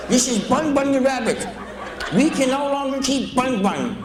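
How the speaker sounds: high-pitched mock customer; crazy woman's voice. Crazy woman returns rabbit to pet shop, high mock voice. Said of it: "this woman talked like this".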